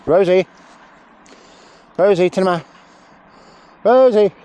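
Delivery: high, loud shout of the dog's name; calling out. Real, not acted. Trainer shouting the dog's name across the field.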